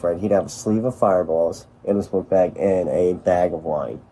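ordinary talk

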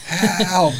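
high, drawn-out panicked cry; mock scream. Dad imitating his daughter's screaming after a dead leg. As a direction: wail like a kid in mock panic. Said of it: "she was just screaming".

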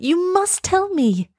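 put-on very high, slow, pleading girl; high-pitched desperate plea; urgent cry. A narrator's reading. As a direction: beg insistently, stretching the words. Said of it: "cried Elsa".

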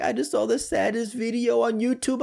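high-pitched imitated tearful voice; mock crying wife. Wife tells husband about sad video, mock tearful. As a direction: play a weepy caller, hammed up. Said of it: "And she's crying".

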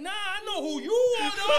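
high, loud starstruck yell; excited recognition. Retold or imitated. Clerk recognizes the comedian instead of rapper, excited yell. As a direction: shout over laughter, thrilled.

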